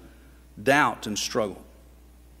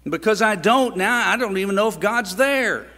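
higher, louder complaining voice with a faster middle; voicing a frustrated believer; 7 semitones higher, 5 dB louder, faster than usual. Believer complaining to God about unanswered prayer, frustrated. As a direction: act out a believer venting at God. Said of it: "we say".

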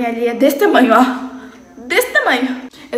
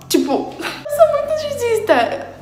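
playful high narcissist; 11 semitones higher, slower than usual. Real, not acted.

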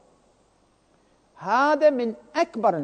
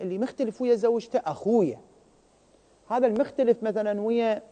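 ordinary delivery

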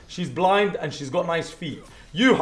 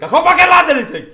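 loud, much higher furious yell; frustrated shout; 13 semitones higher, 14 dB louder than usual. Real, not acted. Streamer raging at his missed abilities, furious shout. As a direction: explode at your own misses.

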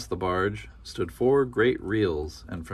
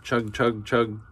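puffing tugboat voice; 2 semitones higher, 4 dB louder, slower than usual. A narrator's reading. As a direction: puff it out like a little tugboat.

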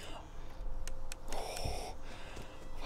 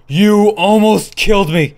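shaken outburst; 5 semitones higher, 5 dB louder, slower than usual. Real, not acted. Narrowly escaping the monster.

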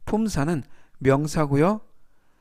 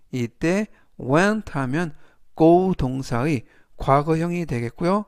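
ordinary talk

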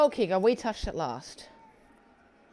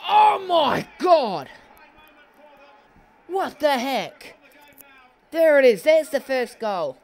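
loud, high, drawn-out celebration; ecstatic goal shout; 6 semitones higher, 8 dB louder, slower than usual. Real, not acted. Gamer celebrating his first goal, ecstatic shouting. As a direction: shout it slowly, overwhelmed by the goal.

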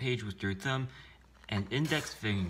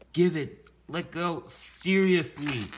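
high, loud and insistent; exasperated demand; 7 semitones higher, 6 dB louder than usual. Acted. Mayor tugging a hat from the dog, exasperated shout. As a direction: demand it back, stretching the last word.

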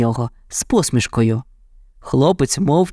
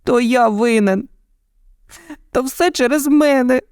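put-on high child's lament; acted tearful boy; 11 semitones higher than usual. A narrator's reading. Boy blames himself over the bear, high tearful voice. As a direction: wail high in self-blame. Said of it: "і розплакався".

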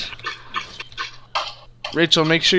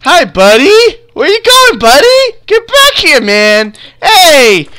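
much higher, loud mocking yell; taunting suspect; 13 semitones higher, 10 dB louder, slower than usual. Suspect mocking the trainee, high and shouting. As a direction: shriek after the cop like a cartoon troublemaker.

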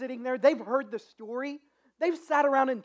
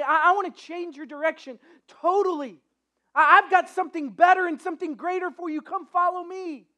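rising passionate plea; 5 semitones higher, 6 dB louder than usual. Acted. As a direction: pour your heart into the invitation. Jesus passionately recalls calling Peter.

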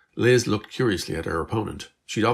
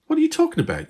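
incredulous; 6 dB louder, faster than usual. Acted. Liz confronts the stranger.

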